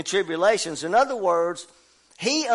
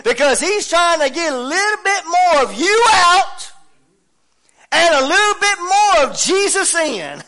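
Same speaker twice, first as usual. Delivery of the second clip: loud, rising preacher's cadence, words hammered out; preaching at full force; 7 semitones higher, 7 dB louder than usual. Acted. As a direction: preach it to the whole house.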